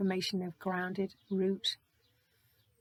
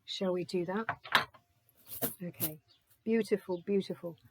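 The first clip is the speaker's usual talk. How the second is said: usual speaking voice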